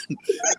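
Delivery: squeaky high laugh cracking out; real high-pitched laugh; high burst of laughter. Real, not acted. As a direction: break into a high giggle. Laughing at his own story.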